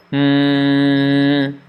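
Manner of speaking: long nasal hum through the nose; humming mm. Shown as a demonstration. Teacher demonstrating nasal sound with a long hum. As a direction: hum with lips closed.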